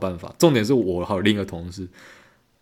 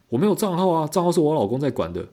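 pitched-up whiny lady customer; mock high voice of the clueless caller; imitating customer; 7 semitones higher than usual. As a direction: play the helpless caller, voice raised high. Customer on the phone complaining.

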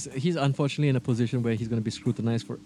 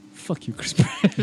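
mock curse; 3 semitones higher, slower than usual.

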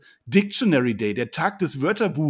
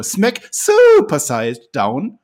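ring announcer; 9 dB louder, much slower than usual. Pretend. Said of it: "ich darf es wieder machen".

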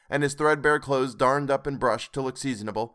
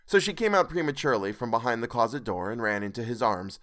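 usual speaking voice

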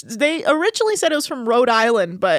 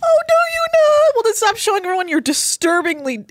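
very high, louder mock-incredulous squeal; sarcastic retort; 11 semitones higher, 4 dB louder than usual. Real, not acted. Co-host retorting to thumb joker's complaint, high sarcasm. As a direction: throw it back in high, mocking disbelief.

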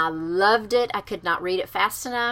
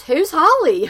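husband's question; 8 semitones higher, 8 dB louder, slower than usual. Retold or imitated. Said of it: "He goes".

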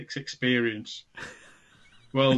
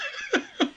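high burst of laughter; 3 dB quieter than usual. Real, not acted. Laughing at joke.

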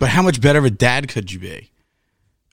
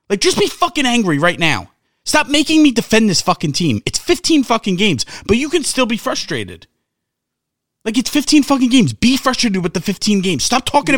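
pitched up, exasperated rant at the fans; frustrated rant; 7 semitones higher than usual. Real, not acted. Fan ranting at fellow fans, higher and exasperated. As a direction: vent it, climbing higher.

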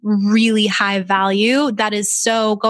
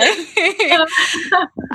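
a high laugh that tips into tears; laughing and crying at once, real; tearful laugh; 8 semitones higher than usual. Real, not acted. Host moved by guest's words, laughing through tears. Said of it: "I'm getting emotional".

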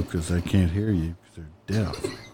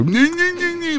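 very high squealed syllables; mock the sounds back; mocking imitation. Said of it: "that's how they sounded".

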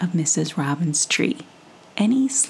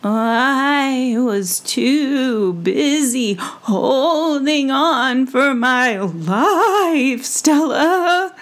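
high, very slow, louder squeak; shaken mouse; 6 semitones higher, 4 dB louder, much slower than usual. Acted. Dizzy mouse answering the squirrel, slow high squeak. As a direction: hang on for dear life. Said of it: "mouse answers, dizzy".

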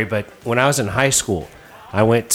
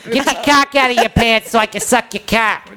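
high-pitched, hammed-up Boston accent; 11 semitones higher, 3 dB louder than usual. Boston girl propositions a caller, hammed-up Boston accent. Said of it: "a girl from Boston".

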